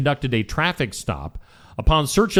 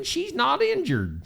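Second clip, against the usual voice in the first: high cooing; 12 semitones higher, 4 dB quieter than usual. Pretend. Cooing over the kangaroo.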